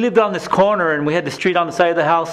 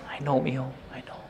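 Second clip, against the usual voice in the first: soft whisper; 5 semitones lower, 12 dB quieter, faster than usual. Retold or imitated. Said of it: "She whispered back in my ear".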